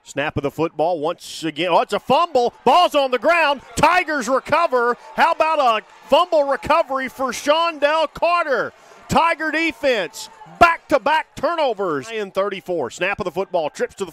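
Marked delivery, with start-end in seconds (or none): 1.7-12.1 excited shout. Real, not acted. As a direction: yell it out as it happens. Fumble recovered, announcer shouting.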